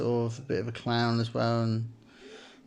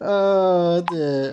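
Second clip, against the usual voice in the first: louder, higher "oh dear" through laughter; laughing; 6 semitones higher, 7 dB louder than usual. Real, not acted. Recovering from a laughing fit, laughing. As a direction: sigh it out while still laughing. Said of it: "I'm laughing my ass off".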